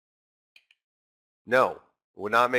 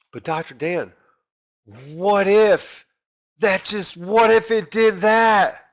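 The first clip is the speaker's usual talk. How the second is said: questioning student voice; 6 semitones higher, slower than usual. Retold or imitated. Voicing a student's objection.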